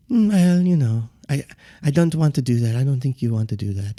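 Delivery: hesitant, passive imitation; put-on polite reluctance; Joe Haas. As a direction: mimic a mild man gently backing off. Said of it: "very quiet and passive in the way he talks".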